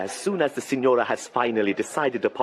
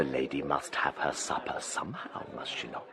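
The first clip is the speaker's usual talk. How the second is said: whispering; 11 semitones lower, 8 dB quieter than usual. Acted. Slope speaking under his breath.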